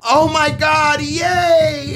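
high mock-happy "yay"; sarcastic shouted yay; fake cheer. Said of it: "I would type like".

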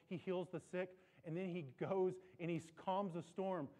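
ordinary delivery

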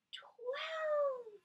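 big high twelve to end the count; excited high finish. Acted. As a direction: land the last number with delight. Counting climax.

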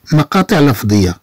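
ordinary talk

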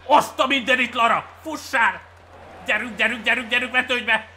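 loud, high shouts of encouragement; excited urging. Real, not acted. Gamer urging Lara to dive in, excited shouting.

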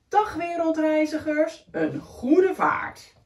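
fond farewell. Acted. Snails wish the world travellers a good voyage, fond. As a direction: wave them off gently.

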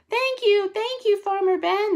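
high, excited cub voices; delighted bear cubs cheering in a high pitch; overjoyed shout. A narrator's reading. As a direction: call out thanks high and joyfully. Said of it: "they shouted".

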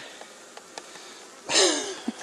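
long breathy, voiceless laugh; disbelieving laugh. Real, not acted. Beekeeper astonished by the mass of bees, laughing in disbelief. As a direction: let out an incredulous laugh.

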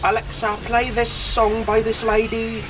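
mock sing-song Scottish lilt, slow and high; imitating a Scottish woman talking to Alexa; lightly done Scottish accent asking a smart speaker. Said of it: "Such a sing-songy accent".

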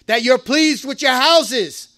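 ordinary talk, nothing marked

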